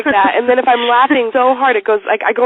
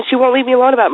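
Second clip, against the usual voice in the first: usual speaking voice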